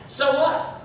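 high challenging "So what?" with a rise; asking so what. Shown as a demonstration. Teacher shows students the question a conclusion asks, high and pointed. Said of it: "a conclusion should ask the question".

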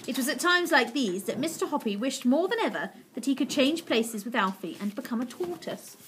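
plain reading voice

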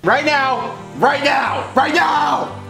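urgent. Real, not acted. Urgent repeated plea.

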